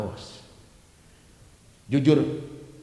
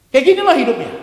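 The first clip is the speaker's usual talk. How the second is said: much higher and louder exclamation; preaching shout; 13 semitones higher, 12 dB louder, faster than usual. Real, not acted. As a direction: cry it out to the whole congregation. Same words repeated at full force. (seruan khotbah yang lantang)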